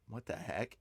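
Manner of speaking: low, very soft "what the heck"; hushed aside to oneself; retold muted disbelief. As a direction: say it under your breath, barely voiced. Retold first quiet reaction.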